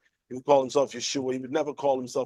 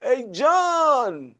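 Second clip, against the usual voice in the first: high call across a room; high, playful mock call; 9 semitones higher, much slower than usual. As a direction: hail someone by the wrong name. Calling the wrong name.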